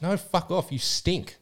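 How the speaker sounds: blunt brush-off. Retold or imitated. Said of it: "That's how she said it".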